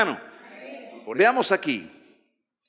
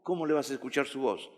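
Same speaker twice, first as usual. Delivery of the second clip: usual speaking voice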